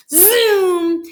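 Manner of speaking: high, stretched zoom; long ringing whoosh of a word; zooming noise. A narrator's reading. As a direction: send it off like a racing engine. Acting out the speeding wheelchair.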